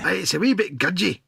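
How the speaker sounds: put-on a playful Scottish accent on wee; joking Scottish accent; mock Scottish accent. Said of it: "That sounds almost Scottish".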